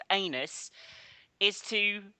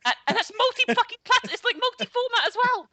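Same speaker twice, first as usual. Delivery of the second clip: high squeaky excited voice; 6 dB louder than usual. Retold or imitated. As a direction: squeak it out, overexcited. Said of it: "they do get a squeaky voice".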